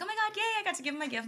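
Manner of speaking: high, fast giddy squeal; giddy excitement. Retold or imitated. Fan gushing after giving her hero a gift, fast and giddy. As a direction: gush it out like a thrilled fan.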